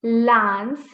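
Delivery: LANCE with a long ah, like "lahn-suh"; correct LANCE vowel. Shown as a demonstration. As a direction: open LANCE into an ah. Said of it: "it's not lancer".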